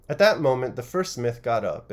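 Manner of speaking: ordinary delivery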